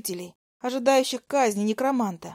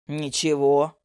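low elder voice; 7 semitones lower, much slower than usual. A narrator's reading. Elder shrugs it off.

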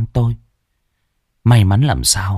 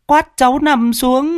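put-on high child's voice, stumbling and scared; a shaken little boy stammers answers to grown-ups; stammering little boy. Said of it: "Thằng cu tít lắp bắp". (giọng bé trai lắp bắp, cao)

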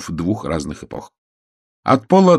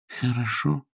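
soft, higher, meek reply; numb with fear; 5 semitones higher, 7 dB quieter, slower than usual. A narrator's reading. Frightened Bream agrees to put on his coat, meekly. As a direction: agree in a small, obedient voice. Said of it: "Страх сковал все чувства Брима Мортимера".

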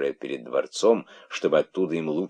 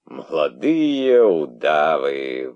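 slow, louder sarcastic sigh; weary sarcastic sneer; hopeless sarcasm; 6 dB louder, much slower than usual. Acted. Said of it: "выдохнул он с безнадёжным сарказмом".

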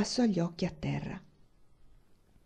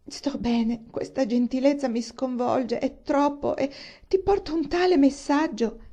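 rising anguish, each phrase pitched up; Eleanor distressed; 6 semitones higher, 3 dB louder than usual. A narrator's reading. Eleanor, unable to deliver bad news, rising in pitch. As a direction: voice climbing ever higher as she falters.

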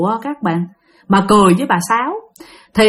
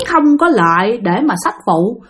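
ordinary delivery, nothing marked

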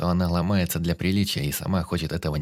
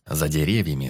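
plain reading voice

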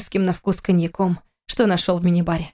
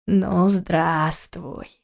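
медленно, хищно, низким шёпотом; протяни как зловещее чудовище; голос чудовища; 2 semitones lower, much slower than usual. Acted. Морда чудовища в стекле. (зловещий голос чудовища)

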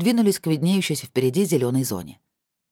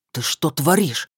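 growled; slower than usual. Acted. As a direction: growl it at her. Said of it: "рыкнул он".

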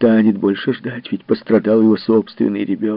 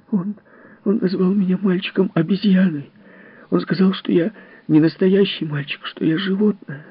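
put-on high, crying little boy's voice; a hurt child sobbing out his story; tearful child; 10 semitones higher than usual. Timmy tells Miss Fellowes about the bully, high and tearful. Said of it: "Из глаз Тимми хлынули слёзы".

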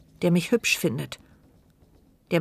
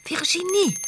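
alarmed call; 11 semitones higher than usual. Acted. Alarmed narrator calls out in thought.

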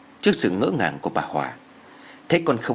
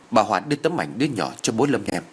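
ordinary delivery, nothing marked

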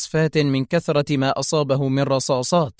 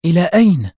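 raised, louder musing question; deep in thought; 4 semitones higher, 6 dB louder, faster than usual. A narrator's reading. Boss repeating the question to herself, pondering. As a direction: repeat it as if puzzling it out. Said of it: "تكرر في تفكير عميق".

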